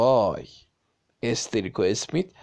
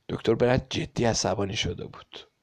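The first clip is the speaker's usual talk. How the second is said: ordinary delivery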